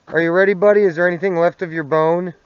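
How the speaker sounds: loud call to the dog. Real, not acted. Calling the dog over.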